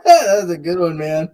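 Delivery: high, laughing praise. Real, not acted. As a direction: laugh through the compliment. Laughing after the joke.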